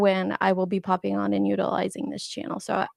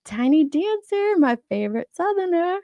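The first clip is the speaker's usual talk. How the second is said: put-on Southern accent; 8 semitones higher than usual. Host greeting her favorite Southerner in chat, imitated Southern accent. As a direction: draw it out high in a playful Southern accent. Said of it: "I just love her accent".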